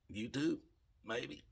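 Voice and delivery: hushed, rising half-question; tentative musing. Retold or imitated. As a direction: think it aloud softly, pitch lifted at the end. Tentative thought voiced aloud.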